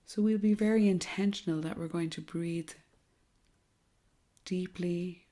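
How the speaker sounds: soft, hushed meditation voice with long pauses; guided meditation. Acted. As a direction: guide them gently through each breath, softly and unhurriedly.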